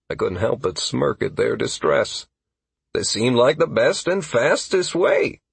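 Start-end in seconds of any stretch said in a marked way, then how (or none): none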